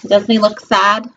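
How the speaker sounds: plain reading voice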